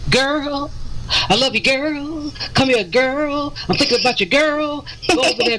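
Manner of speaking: put-on high sing-song lines, each ending on "girl"; mock repetitive GIRL. Mocking a singer who said girl every other sentence, repetitive high "girl". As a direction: tack "girl" onto every phrase, pitched high. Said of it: "wore us out with the word girl".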